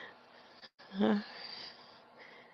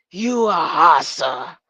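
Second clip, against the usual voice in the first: loud, slow rap-star growl; 9 dB louder, slower than usual. Retold or imitated. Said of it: "In my [name] voice".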